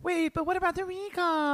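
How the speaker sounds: mock her in a squeaky voice; high mocking voice. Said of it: "and then you go".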